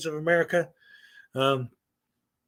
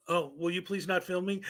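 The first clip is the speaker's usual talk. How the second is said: soft, quieter plea not to be filmed; meek request; 8 dB quieter than usual. Acted. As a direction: ask softly and politely. Plays the woman asking not to be filmed.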